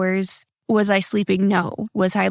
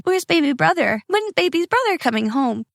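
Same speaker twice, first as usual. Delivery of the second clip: put-on higher, childlike questioning voice; imitating young kids; 7 semitones higher than usual. Mother retelling her small kids asking for their brother, lightly childlike. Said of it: "my two oldest are asking".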